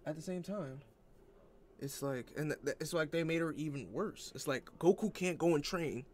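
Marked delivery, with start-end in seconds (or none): none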